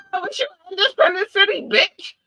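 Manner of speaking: very high, fast, sassy taunt; high mocking retort to a city asking for help; mocking sass. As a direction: rattle it off high and snide, whisper the last word. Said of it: "And you'd be like".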